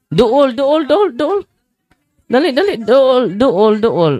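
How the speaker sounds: high, loud rapid calls to hurry up; eager urging. Real, not acted. Priest hurries the children to shout the answer, excited. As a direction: egg them on, high and loud.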